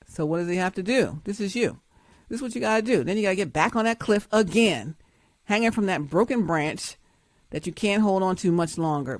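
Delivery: ordinary talk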